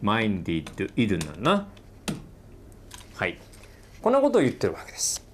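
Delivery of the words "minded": minded said slowly as MINE-did, each sound drawn out; pronunciation demo. Shown as a demonstration. Teacher modelling minded for students, slow and careful. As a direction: spell out minded clearly for the class, mine-did. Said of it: "この発音は".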